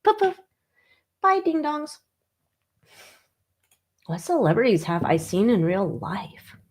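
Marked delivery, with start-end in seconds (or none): 0.0-2.0 high mocking goodbye. Dismissive send-off.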